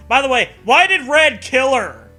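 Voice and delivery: normal talking voice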